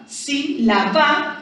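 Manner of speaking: "sílaba" split slowly into sí-la-ba; syllable by syllable. Shown as a demonstration. Teacher separates the word for pupils, slow syllables in "sílaba". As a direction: spell out "sílaba" slowly, each syllable apart. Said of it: "cargamos, ¿cierto? En sí".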